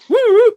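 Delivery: high, loud two-note whistle; piercing imitated summons whistle. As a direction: whistle sharply, as if calling the pups in. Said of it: "he just whistle".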